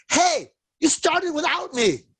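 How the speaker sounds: high, slow, cheeky quip; playful quip. Retold or imitated. Therapist joking to a crying client, high slow cheeky quip. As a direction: tease her gently out of the slump. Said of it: "I looked at her and I go".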